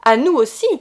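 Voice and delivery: loud mocking cry; loud gloating cry; shouted. A narrator's reading. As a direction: shout it over her head. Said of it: "cria-t-il".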